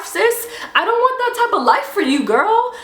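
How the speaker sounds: high, sarcastic sweet concern; mock sympathy. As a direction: coo it with fake pity.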